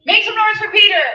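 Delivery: loud, high call for applause; hyped MC shout. Real, not acted. Host asking crowd to applaud Peter, hyped shout. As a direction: whip the audience up into cheering.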